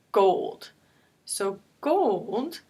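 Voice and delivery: "gold" with a drawn-out long O. Shown as a demonstration. Teacher showing the long O sound, "gold" with O held long. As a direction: stretch the O in "gold" so it says its name. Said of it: "we have this long O, like in the word".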